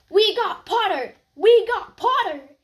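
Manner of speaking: high, loud gleeful yell; Weasley twins yelling. Acted. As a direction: crow it across the hall. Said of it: "the Weasley twins yelled".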